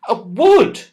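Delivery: a high, loud cry of delight. A narrator's reading. Beth spotting the wood, a high delighted cry. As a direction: burst out with joy at finding it. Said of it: "said Beth in delight".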